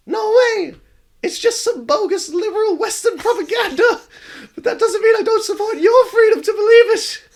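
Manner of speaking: high giddy shouting ghost; excited ghost girl. Acted. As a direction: ghost girl gushing with giggles.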